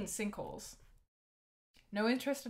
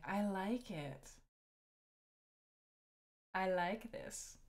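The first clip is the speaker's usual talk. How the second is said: put-on slow, soft, half-hearted country accent; failed country accent; 2 semitones lower, 8 dB quieter, much slower than usual. Streamer voicing a rainy-day line, failing at a country accent. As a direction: try a country accent and miss it. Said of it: "I cannot do a country accent".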